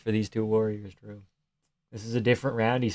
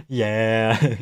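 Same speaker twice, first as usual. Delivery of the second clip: normal talking voice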